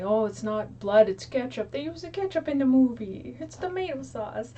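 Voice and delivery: put-on high-pitched, loud Italian accent; Italian-accented dad. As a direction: imitate an Italian father insisting, pitch climbing. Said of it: "hear it in an Italian accent".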